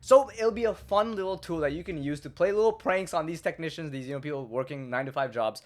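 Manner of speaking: ordinary delivery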